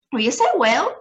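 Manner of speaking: defiant little squeak. A narrator's reading. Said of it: "squeaked the tiny voice of Mike T V".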